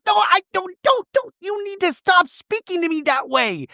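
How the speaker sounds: very high, mock-indignant squeal; shrill Shannon impression. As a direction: shoot the voice up high like a wounded protest. Shannon snapping back, retold.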